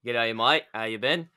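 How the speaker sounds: a quick mock Aussie greeting; terrible Aussie accent. Host greeting his Australian guest in a terrible Aussie accent. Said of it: "that was a terrible Aussie accent".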